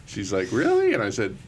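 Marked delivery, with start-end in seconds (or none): 0.5-0.9 shocked high "Really?"; high squeak of disbelief; surprised. Retold or imitated. Wife's reply retold.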